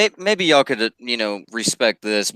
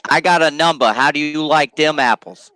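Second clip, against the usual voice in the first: loud, higher Boston accent impression; Boston movie accent; 5 semitones higher, 4 dB louder than usual. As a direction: quote the film in cocky Boston speech. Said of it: "the fucking Good Will Hunting movie".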